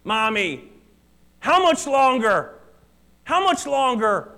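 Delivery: a lightly voiced child whining from the back seat; whiny child. Toddler in the back seat whining at mommy, mock whine. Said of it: "you hear your kids saying".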